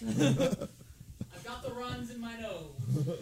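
slow, laughing through it. Real, not acted. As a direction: laugh while complaining. Hot sauce burning nose.

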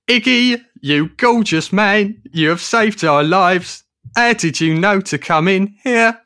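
high, loud, slow gushing; overjoyed. Acted. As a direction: gush with relief and joy. Overjoyed greeting of rescuer.

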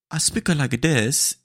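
slow, high mock Italian accent; bad Italian accent. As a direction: mimic her bad Italian accent, drawn out. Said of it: "is a bad Italian accent".

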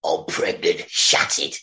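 low, hushed Cockney soap melodrama; EastEnders parody. Acted. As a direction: drop low for mock soap-opera drama. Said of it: "condensed version of EastEnders".

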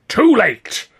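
high, loud crowing shout; exultant interruption; triumphant cry. A narrator's reading. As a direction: burst in with gloating victory. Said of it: "Considine cried triumphantly".

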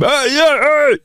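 high shouted hey from the street; mock shout. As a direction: call out like a rowdy drinker. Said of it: "shout at me when I come".

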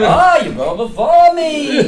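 high, slow, overly polite; high mock-polite old voice. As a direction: play the polite old man, laughing.

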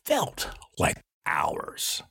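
very slow, low, weary emphasis; drawn out. Real, not acted. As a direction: drag out "felt like hours" word by word. Dramatising how long it felt.

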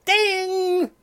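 high sung ding; mock game show ding; ding chime. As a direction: imitate the correct-answer bell.

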